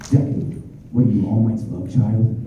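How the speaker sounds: understated, inward character voice; Todoroki. Shown as a demonstration. Voice actor performing a famous line to Deku for fans, understated. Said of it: "can you do your Todoroki voice".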